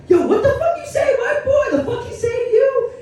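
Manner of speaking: confronting bystanders. Retold or imitated. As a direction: square up and challenge him.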